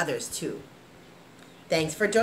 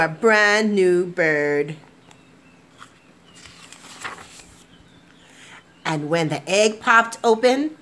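gentle, expressive storybook narration; lively picture-book reading to kids; reading to children; 2 semitones higher than usual. A narrator's reading. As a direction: read it like bedtime story time. Reads picture book aloud.

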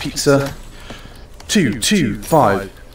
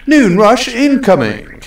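announcer call; 8 semitones higher, slower than usual. Pretend.

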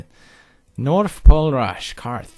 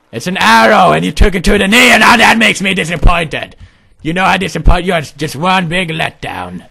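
louder, raised dad rant; berating father; 6 semitones higher, 8 dB louder than usual. Acted. Father scolding wounded son, loud and disappointed. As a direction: lecture your son in disappointment.

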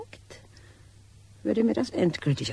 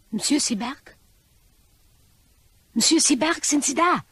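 loud, high call into the dark park; 10 semitones higher, 6 dB louder than usual. Acted. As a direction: call out for him across the park. Calling out in empty park. (laut rufend in den leeren Park)

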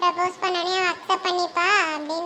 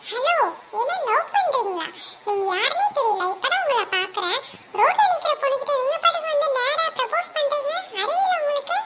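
put-on higher girl's voice, scolding the stranger; indignant young woman; 7 semitones higher than usual. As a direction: push him off, pitched up and offended. Kavi rebuffs stranger's proposal. (உயர்ந்த குரலில் கோபமான இளம்பெண்)

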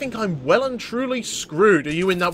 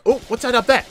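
ordinary talk

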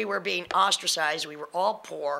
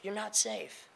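usual speaking voice

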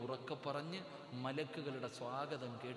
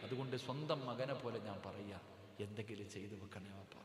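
ordinary talk, nothing marked

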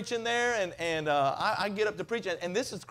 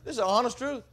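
ordinary talk, nothing marked

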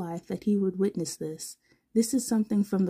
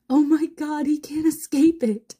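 high, loud, gleeful cry; high loud delighted exclaim; delighted squeal; 8 semitones higher, 6 dB louder than usual. Acted. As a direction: shriek with glee. Hisashi delighted at loopy Zawa.

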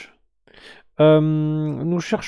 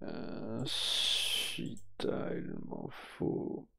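slow, soft whisper; slow hushed murmur; 8 semitones lower, 13 dB quieter, much slower than usual. Real, not acted. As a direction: drop to a murmur while drawing the next winner. Streamer hushes before next draw.

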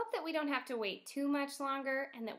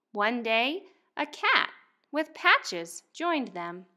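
louder, lively picture-book read-aloud; reading to children; 12 dB louder, slower than usual. Acted. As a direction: read it out to a class of first graders. Teacher reads picture book aloud.

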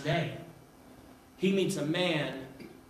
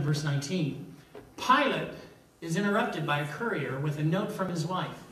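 usual speaking voice

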